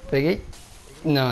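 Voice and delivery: ordinary talk, nothing marked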